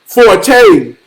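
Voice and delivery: "Forte" drawn out as for-TAY; two-syllable Forte. Retold or imitated. Teacher correcting a boy's name, "Forte" as for-TAY. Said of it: "You pronounce your name".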